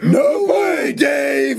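very high mock shouting; neighbor's speed fit. Neighbor having a fit at Dave, high hammed-up shouting. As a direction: shriek protests like an overwrought neighbor. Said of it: "Be like".

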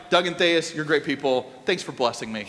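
quick offhand thanks. Retold or imitated. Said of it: "It wasn't like".

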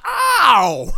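high, loud "ow" of pain; mock pained yelp. Imitating a kid hurt in play wrestling, mock yelp. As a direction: yelp like a kid getting hit.

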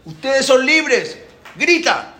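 high, loud, stretched proclamation; shouting. Acted. Moshe orders Pharaoh to proclaim freedom, shouting. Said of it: "Tienes que gritar".

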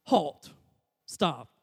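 ordinary delivery

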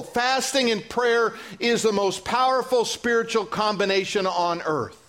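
ordinary talk, nothing marked

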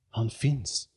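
high-pitched gasp of delight; excited exclamation. Acted. Annie, seeing the great bird, exclaims in high-pitched amazement. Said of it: "utropade hon".

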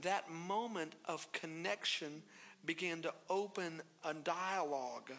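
ordinary talk, nothing marked

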